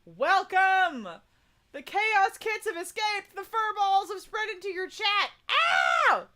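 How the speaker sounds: high, loud excited welcome; excited shout. Real, not acted. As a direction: cheer them into chat. Excited welcome of chaos kids.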